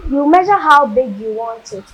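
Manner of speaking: ordinary talk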